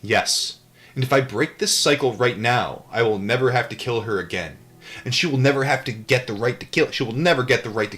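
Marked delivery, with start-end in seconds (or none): none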